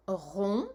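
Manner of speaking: rond said with no final d sound, just nasal on; rond, silent d. Shown as a demonstration. La maîtresse dicte à l'enfant: rond, sans d final. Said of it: "dans le mot rond, il y a une lettre muette".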